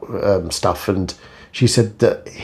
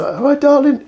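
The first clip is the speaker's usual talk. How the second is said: much higher, sing-song Cockney greeting; old London greeting; 3 dB louder than usual. Retold or imitated. The vlogger imitates old London folk greeting someone, high sing-song. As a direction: toss it out like an old London charmer. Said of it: "people used to say".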